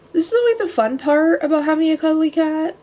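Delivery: ordinary delivery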